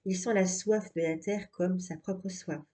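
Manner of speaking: usual speaking voice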